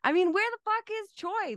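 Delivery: imitated indignant outburst, pitched high; mock aggression. Repeating a favorite movie line, mock aggressive. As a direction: demand it with playful outrage. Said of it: "he comes in with such aggression".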